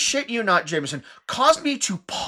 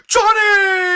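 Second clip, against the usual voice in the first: much higher, louder cry of a name; mock anguished yell; 12 semitones higher, 8 dB louder than usual. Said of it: "we do in fact hear a voice go".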